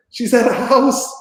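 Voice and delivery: high, loud shocked cry; alarmed discovery. Retold or imitated. Parents spotting the daughter's location on the phone, shocked shout. Parents checking Find my Friends.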